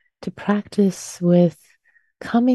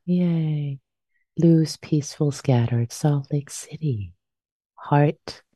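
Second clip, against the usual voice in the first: normal talking voice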